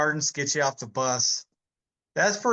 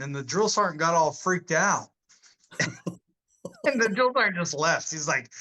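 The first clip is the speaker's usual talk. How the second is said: normal talking voice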